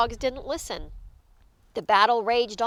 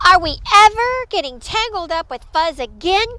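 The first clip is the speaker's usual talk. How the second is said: fast, high, loud rallying question; spirited little leader's call; crying out; 6 semitones higher, 6 dB louder, faster than usual. A narrator's reading. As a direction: fire up the crowd. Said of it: "cried Pip Squeak".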